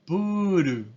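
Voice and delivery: puru as pu-ru, tapped r; Japanese loanword. Shown as a demonstration. Teacher showing students the Japanese reading: プール. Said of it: "Entonces tenemos".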